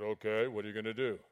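low wary questioning; big man's low voice. Retold or imitated. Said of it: "I said".

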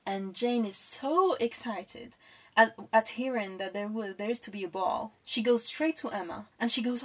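usual speaking voice

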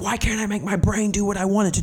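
high, strained, near-tearful plea; a cracked, pleading cry to himself; retold sobbing. Alone in the car after bombing, pleading through tears. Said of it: "I was, like, sobbing".

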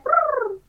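high rolled bird trill. Retold or imitated. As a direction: trill it like a parrot. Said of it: "I'd be like".

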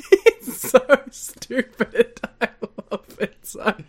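laughing through the words, drawn out. Real, not acted. Host laughing at the wrestling storyline, laughing. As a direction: giggle it out slowly.

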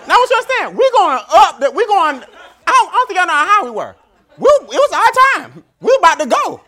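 rising hype with laughter; laughing, fired up about the height; excited laughing. Retold or imitated.

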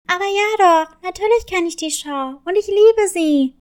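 bursting with joy over a favourite show; delighted cat. Acted.

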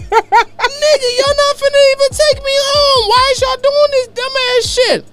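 very high falsetto, slow and whiny; girl's falsetto. Retold or imitated. Driver girl complaining about being blocked in, high whiny falsetto. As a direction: squeal it like the annoyed driver.

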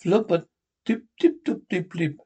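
gibberish played in reverse; backwards speech. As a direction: talk as if the tape runs backwards. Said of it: "speaks backwards".

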